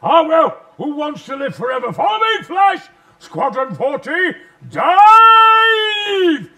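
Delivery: rally the squadron into the dive; leading the Hawkmen's attack with a roaring battle cry. Retold or imitated. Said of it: "I just said".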